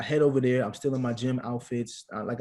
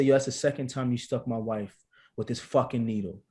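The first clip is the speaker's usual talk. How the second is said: ordinary delivery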